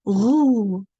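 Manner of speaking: emphatic French "Roux" for learners to copy; silent x. Shown as a demonstration. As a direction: repeat "Roux" as a French model, x unsounded. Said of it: "Say it, please".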